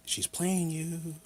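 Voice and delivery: high, slow, stretched-out warning; imitated friend's warning. Friend Mike warning him about her, high slow warning.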